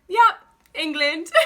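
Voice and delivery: high, loud answer in a faked English accent; put-on English accent. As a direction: commit to the fib in a pretend English accent. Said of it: "it made me so uncomfortable".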